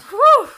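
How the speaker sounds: loud, high exhaled whoop; swooning whew. Real, not acted. Gushing over a favorite player, loud swooning whew. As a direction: blow out a big, high "whew".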